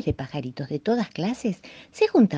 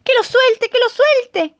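high, loud demanding chant; lark shouting; 9 dB louder, slower than usual. A narrator's reading. Lark demanding the eaglet drop him, loud high shout. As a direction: shout it to make him let go. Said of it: "gritaba la calandria".

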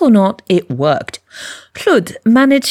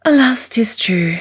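whispered mock lament.

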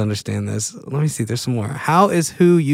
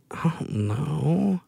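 mock despair; 8 dB quieter, slower than usual. Real, not acted. Stuck on a quiz question.

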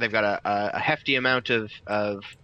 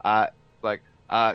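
normal talking voice

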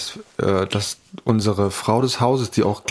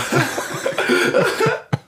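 high breathy laugh. Real, not acted. Laughs at the bleep joke.

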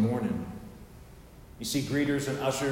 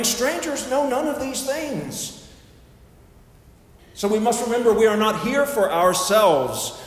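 raised, emphatic pulpit cadence; preaching; 7 semitones higher, 7 dB louder than usual. Real, not acted. Preacher reminds members they are here for strangers, forceful preaching. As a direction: press it on the congregation phrase by phrase.

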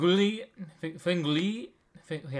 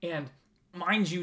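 ordinary talk, nothing marked

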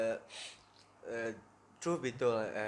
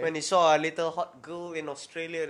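ordinary delivery

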